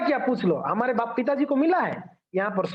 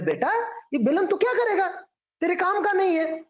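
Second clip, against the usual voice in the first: lightly voiced mother, pitched up; dissuading mother; 7 semitones higher than usual. Mother refuses her child a balloon, lightly acted dissuading. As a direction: mildly imitate a parent talking a child out of it.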